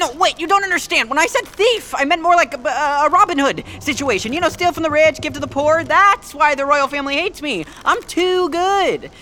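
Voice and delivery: panicked pleading. Acted. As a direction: talk fast so the prince won't walk off.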